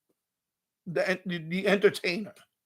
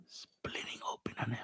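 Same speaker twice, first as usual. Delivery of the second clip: hushed whisper; 19 dB quieter than usual. Retold or imitated. Describing dancer, hushed aside.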